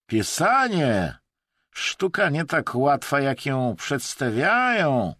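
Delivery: loud, high, drawn-out smug sympathy; mock-understanding with clear satisfaction in it; feigned understanding, satisfied. A narrator's reading. Russian needles his slow-writing companion, smugly sympathetic. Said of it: "niby to wyrozumiale, lecz z wyraźną nutą satysfakcji".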